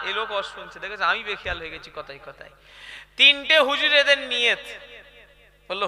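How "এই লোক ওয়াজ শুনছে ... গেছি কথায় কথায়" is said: ordinary talk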